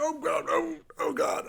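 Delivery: high, frantic oh God; panicked cry. Acted. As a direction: wail it, running away. Fleeing in panic.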